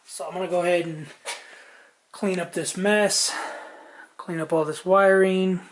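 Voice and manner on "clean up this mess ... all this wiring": ordinary talk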